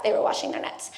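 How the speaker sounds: whispered "They were washing their nets"; secretive whisper to listeners; whisper for emphasis. Shown as a demonstration. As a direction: drop to a whisper to make it stick. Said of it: "Make a note of that".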